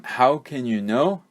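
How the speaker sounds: ordinary delivery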